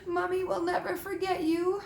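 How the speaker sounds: high, weepy promise; a lightly sobbing giant mother; tearful farewell. A narrator's reading. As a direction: coo it through mock tears. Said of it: "Hagrid sobbed".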